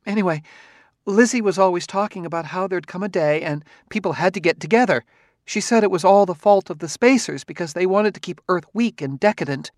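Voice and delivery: rambling, nervous wife. A narrator's reading. Wife voiced in story.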